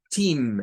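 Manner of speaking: team said as tim, long i; English team. Shown as a demonstration. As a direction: stretch the i in team. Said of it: "sería el sonido de acá, con I". (team con el sonido i del español)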